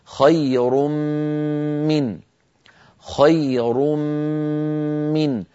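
خير من بميم مشددة مع غنة; لا يبقى للنون أثر; إدغام بغنة. Shown as a demonstration. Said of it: "فيصير النطق". (ميم مشددة بغنة)

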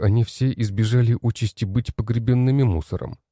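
plain reading voice

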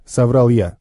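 plain reading voice

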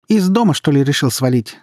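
high, loud derisive question; mocking. A narrator's reading. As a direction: taunt them as they leave. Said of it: "насмешливо спросила Света".

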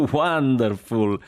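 high, drawn-out cry through laughter; laughing delight. Acted. Jack delighted by the story, crying out laughing. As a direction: cry it out slowly, laughing heartily. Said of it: "Jack rideva così di cuore".

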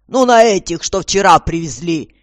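loud, impatient clarification; displeased. A narrator's reading. Commissioner about yesterday's prisoners, loudly displeased. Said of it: "недовольно спросил комиссар".

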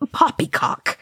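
high, scornful character snarl. A narrator's reading. Oin rejecting Thorin's defeat, snarling. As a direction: spit it out high and dismissive. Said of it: "Oin snarled".

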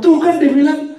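high, loud told-you-so scold; mock scolding parent. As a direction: nag like a fed-up parent.

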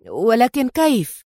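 stretched, rising disbelief; startled question. A narrator's reading. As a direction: ask it in stunned disbelief, slowly. Shaken man challenged, asks how.

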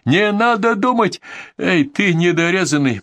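slow, high-pitched wail of a command; narrated yell. A narrator's reading. Bandit yells again at the camp, high and slow. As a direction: drag the words out, high and ranting. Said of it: "а потом завопил опять".

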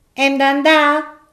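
an impatient wife wanting to go; high, drawn-out nagging question; Martina's voice. Acted.

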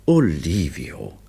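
slow, reproachful name. A narrator's reading. Wife reproaches her husband, slow. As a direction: draw his name out in reproach. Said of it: "sagte Olivia vorwurfsvoll".

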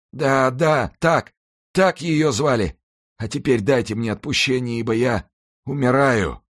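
high, pained pleading voice; anguished dying executioner. Acted. Dying executioner confesses to the monk, high and anguished.

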